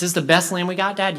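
lightly voiced boy asking for reassurance; doubting boy. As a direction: ask Dad as a worried kid. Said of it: "one boy who comes up to the father".

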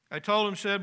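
ordinary talk

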